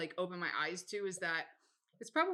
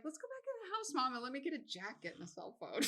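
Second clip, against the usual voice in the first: coaxing son voice; 5 semitones higher, 8 dB quieter than usual. Retold or imitated. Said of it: "Be like".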